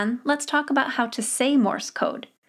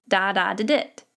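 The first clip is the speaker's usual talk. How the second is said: "da-da-da-dit" as one word, "DAH-DAH-di-dit"; long stressed first two "da", short light "da-dit" ending; 2 semitones lower than usual. Shown as a demonstration. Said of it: "Always put your emphasis on das".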